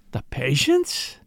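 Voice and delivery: slow, rising, incredulous echo; unbelieving. A narrator's reading. Brother questions what Poirot asked about, unbelieving. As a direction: query it as if you can't believe it.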